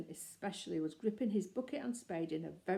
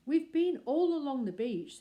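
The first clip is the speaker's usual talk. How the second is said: raised, urgent report; worried report; 7 semitones higher, 8 dB louder than usual. Acted. Jonathan tells the family Paddington is missing, urgent raised voice. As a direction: burst in worried after searching.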